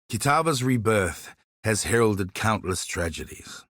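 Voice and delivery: normal talking voice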